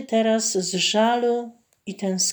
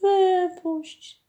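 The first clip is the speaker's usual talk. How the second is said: "wypuść" even higher, falling away at the end; a last high plea, dropping down on "wypuść"; the mermaid's second, higher cry of "wypuść"; begging mermaid; 11 semitones higher than usual. A narrator's reading. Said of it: "błagało cudne zjawisko".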